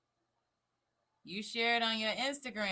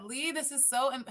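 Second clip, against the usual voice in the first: ordinary delivery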